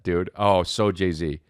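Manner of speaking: usual speaking voice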